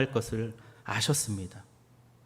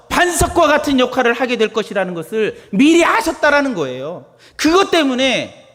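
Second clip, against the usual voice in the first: loud, high-pitched preaching; fiery pulpit delivery; preaching at full force; 9 semitones higher, 9 dB louder than usual. Acted. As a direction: proclaim it to the congregation.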